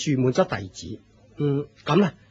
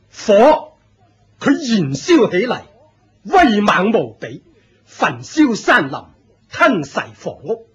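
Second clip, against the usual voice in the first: louder, higher, rising fervour about fire; fervent proclamation; 5 semitones higher, 4 dB louder than usual. Acted. Cult leader preaching fire's power to disciple, fervent and loud. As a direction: preach the fire's might with zeal.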